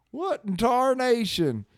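high, slow prospector exclamation; gold miner. Acted. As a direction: drawl it out like an old-time gold miner. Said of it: "That's a gold miner thing".

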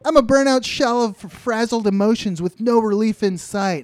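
hammed-up frazzled plea, high and loud; acted pleading. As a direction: plead loudly in an overwrought high voice. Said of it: "she's pleading with the guy".

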